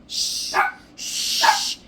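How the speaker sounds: long soothing shush; real shushing to settle someone. Real, not acted. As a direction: hush them with drawn-out shushes. Hushing someone or an animal mid-recording.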